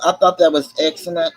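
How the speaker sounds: ordinary delivery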